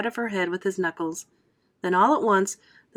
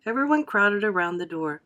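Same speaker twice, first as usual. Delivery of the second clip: ordinary delivery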